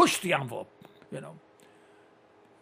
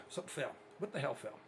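normal talking voice

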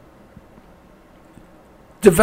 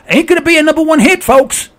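ordinary talk

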